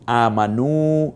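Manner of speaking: আমানু slowly, নু held long. Shown as a demonstration. Teacher demonstrating the long vowel to the class. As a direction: stretch নু in আমানু. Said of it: "নুতে টানলাম কেন?".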